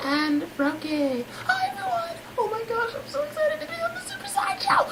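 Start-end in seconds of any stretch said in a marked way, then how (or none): 1.5-4.9 very high, squeaky excited voice; gushing fan. Acted. Guest thrilled to be on the Super Sideshow, gushing.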